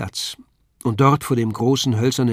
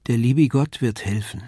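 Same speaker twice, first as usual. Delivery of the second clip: plain reading voice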